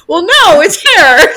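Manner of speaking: loud, high, laughing protest; amused retort. Retold or imitated. Caller answering whether her hair is an emergency, amused retort. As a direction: blurt it out high, on the edge of laughter.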